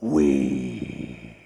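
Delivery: very low, drawn-out French "oui"; deadpan, deep mock French statesman; one long low French yes; solemn French prime minister.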